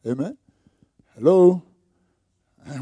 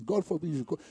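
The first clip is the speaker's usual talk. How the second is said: normal talking voice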